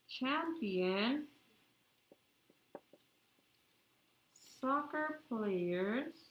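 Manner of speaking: slow, spaced-out dictation with long pauses; dictating. Real, not acted. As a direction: say each word slowly so the class can write it. Teacher dictating a sentence slowly.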